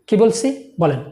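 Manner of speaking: normal talking voice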